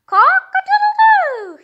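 high, loud, slow rooster crow. Acted. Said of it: "he said".

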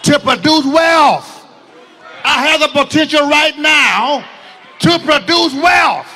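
loud, slow, emphatic proclamation; preacher leading the crowd in a shouted confession; shouted declaration. Shown as a demonstration. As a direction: declare it with full force. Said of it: "Come on, say".

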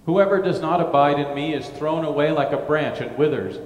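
normal talking voice

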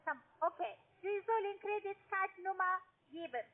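normal talking voice

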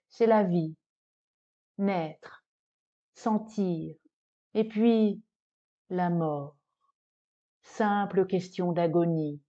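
plain reading voice

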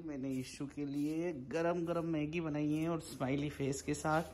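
softly, so as not to disturb anyone; hushed late-night voice. Real, not acted. Husband quietly telling viewers about noodles at night, hushed. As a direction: keep it down, half-whispered at night.

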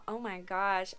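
normal talking voice

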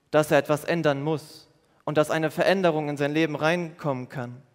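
ordinary talk

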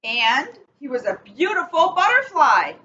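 picture-book read-aloud, measured and clear; reading to children. Acted. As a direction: read it like bedtime story time for kids. Reads picture book to kids.

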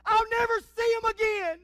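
high, loud, slow wailing scream; mothers' scream. Retold or imitated. Grieving mama at a funeral, screaming in anguish. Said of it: "mamas scream with the intensity".

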